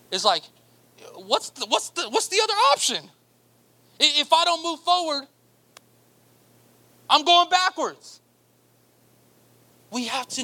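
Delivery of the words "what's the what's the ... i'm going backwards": rising preaching fervor. Real, not acted. Preacher pressing his congregation, building higher and louder. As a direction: climb up and land backwards hard.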